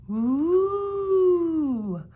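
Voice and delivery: high, loud ghostly howl; spooky howl. Acted. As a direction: howl like a Halloween monster. Spooky howling again.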